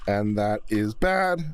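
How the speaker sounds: slow, drawn-out poll option; slow deliberate reading. Pretend. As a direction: read it out slowly like a ballot. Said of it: "reading poll options".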